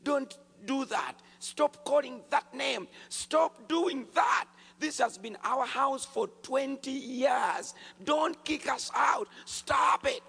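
high, loud, slow demon shout; screaming demon. Retold or imitated. Demon begs the believer to stop calling Jesus, screaming. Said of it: "demon screamed out and said".

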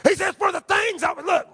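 high, driving pulpit delivery; impassioned preaching. Acted. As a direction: preach it at full force. Preaches at full force.

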